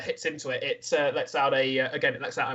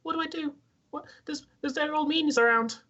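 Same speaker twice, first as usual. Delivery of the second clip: much higher, anxious creature voice; frightened Stool; 13 semitones higher than usual. Acted. Stool asking the party for help, high nervous voice. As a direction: fret like a scared little fungus creature.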